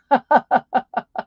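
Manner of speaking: quick run of loud, high ha-ha-has; amused laugh. Real, not acted. Amused after attempting Aussie slang.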